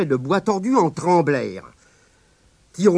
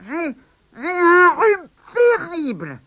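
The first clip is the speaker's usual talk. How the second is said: put-on very high, slow, stuffed-up old woman; old grandma with a cold; 13 semitones higher, 4 dB louder, slower than usual. Sick grandma to her grandson, slow high croak. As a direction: whine it like a sick granny.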